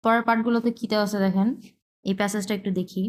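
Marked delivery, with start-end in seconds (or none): none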